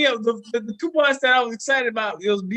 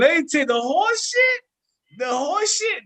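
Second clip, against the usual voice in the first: excited; 9 semitones higher, 3 dB louder, slower than usual. Real, not acted. Excited tale of sex club.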